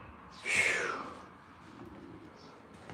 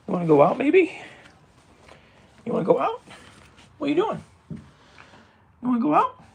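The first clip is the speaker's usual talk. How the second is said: pet talk; 11 semitones higher, 3 dB louder, faster than usual. Real, not acted. Owner asking the cat about going out, high pet talk. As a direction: lift it high and coax, as to a cat.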